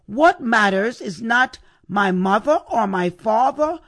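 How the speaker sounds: usual speaking voice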